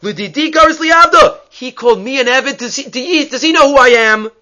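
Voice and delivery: hammed-up offended yell at the rabbi; a litigant screaming that he was called a slave; indignant outburst. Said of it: "he starts screaming at Rav Yehuda".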